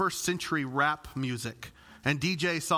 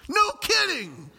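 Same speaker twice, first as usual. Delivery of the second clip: much higher and louder mock "no kidding"; sarcastic retort; 14 semitones higher, 6 dB louder, slower than usual. Pastor answering the stopped-pump message, loud sarcasm. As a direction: snap back at the screen, dripping sarcasm.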